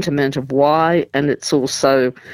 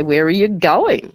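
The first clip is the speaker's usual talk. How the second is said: "going" punched higher with a rise; stress on GOING; 4 semitones higher than usual. Shown as a demonstration. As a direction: save the emphasis for "going" at the end. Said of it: "we might stress certain words".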